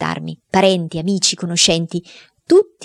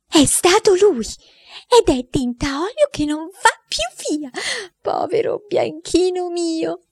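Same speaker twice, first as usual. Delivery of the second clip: aunt wailing; 12 semitones higher, slower than usual. Acted. Aunt wails over dyed dog.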